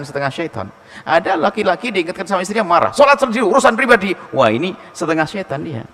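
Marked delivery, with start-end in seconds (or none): 2.9-4.1 high, loud brush-off; irritated husband. Acted. Husband rebuffs his wife's prayer reminder, irritated. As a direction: snap back like an annoyed husband.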